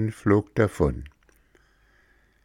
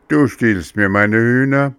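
louder accusing snarl; angry; 4 semitones higher, 6 dB louder than usual. Acted. Farmer accuses the puppet of stealing hens, angry and loud. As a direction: accuse him hotly. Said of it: "sagte der Bauer wütend".

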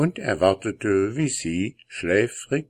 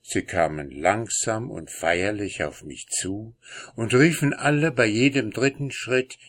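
plain reading voice

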